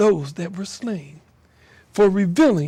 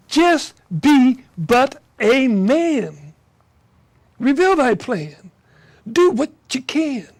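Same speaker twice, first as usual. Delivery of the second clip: exhorting; 3 semitones higher, 4 dB louder than usual. Acted. Poet urging mankind to stand, raised voice. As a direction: rouse the listener to stand up.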